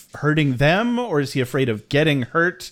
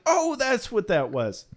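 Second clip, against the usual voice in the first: dawning realization; 9 semitones higher, 3 dB quieter, slower than usual. Retold or imitated. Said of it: "I'm like".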